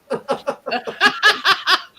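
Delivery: long, high giggling laugh; real laugh. Real, not acted. Chatting about posh biscuits, high giggling laugh. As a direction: giggle away after your own remark. Laughing after own joking remark.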